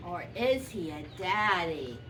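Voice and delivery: ordinary delivery, nothing marked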